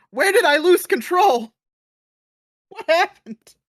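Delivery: loud, high-pitched mock despair; anguished shout. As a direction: yell it out like a man losing it. Acting out Futterman losing control.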